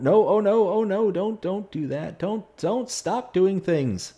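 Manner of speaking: high, loud panicked no; frantic pleading. Real, not acted. Gamer pleading with the runaway vehicle, high and frantic. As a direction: beg the tractor to stop.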